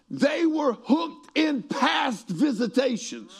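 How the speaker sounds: high, slow preacher's shout; full-force preaching. Real, not acted. Preacher explaining the disciples' mistake, slow shouted preaching. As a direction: punch each word out, pitched well up.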